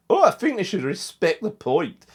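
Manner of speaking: put-on high, loud rubbish Scottish accent; mocking the pundit in a poor Scottish accent; bad Scottish accent. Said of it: "rubbish Scottish, Scottish accent".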